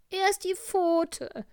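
very high, loud and slow, a pitiful poorly tiger; a hammed-up sick tiger voice, high and drawn out; sick little tiger. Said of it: "sagte der kleine Tiger".

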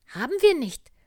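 a kindly little bear voice, high and loud. Acted. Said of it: "sagte der kleine Bär".

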